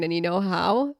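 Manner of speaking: ordinary talk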